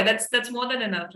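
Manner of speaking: normal talking voice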